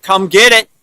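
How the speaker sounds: defiant dare. Retold or imitated. Fearless person daring the controllers, loud taunt. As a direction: dare them to come. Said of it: "If you're like".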